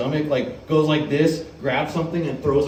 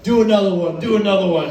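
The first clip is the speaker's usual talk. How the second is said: louder, higher mock crowd chant; egging on; 6 semitones higher, 5 dB louder than usual. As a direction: push them to go again. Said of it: "egging me on".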